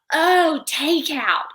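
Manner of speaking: high, loud "oh" of dawning understanding; exaggerated penny-drop reaction; mock sudden realization. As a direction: imitate someone finally getting it. Server finally understands, retold.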